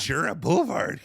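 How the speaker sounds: goofy surfing-dog impression; cartoon surfing dog. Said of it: "cartoon surfing dogs".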